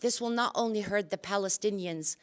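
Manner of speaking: usual speaking voice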